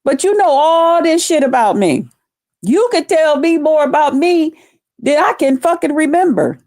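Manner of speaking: high-pitched, incredulous retort; mock amazement. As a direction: act astonished at your hater. Mock retort to someone who dislikes her.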